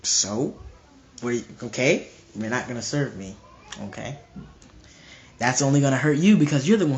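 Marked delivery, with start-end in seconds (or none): 0.0-4.2 low, quiet, indifferent shrug of a reply; unbothered God. Acted. God answering someone who won't serve him, unbothered. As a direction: lower and softer, with pauses, utterly unimpressed. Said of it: "And God's just like".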